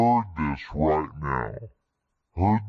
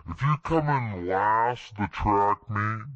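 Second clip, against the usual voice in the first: usual speaking voice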